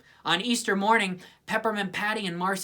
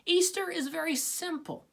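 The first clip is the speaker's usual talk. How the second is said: higher, know-it-all lecture; lightly voiced bossy girl; 11 semitones higher than usual. A narrator's reading. Lucy explaining her Easter plan, lightly high and bossy. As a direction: explain it like it's obvious. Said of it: "she explained".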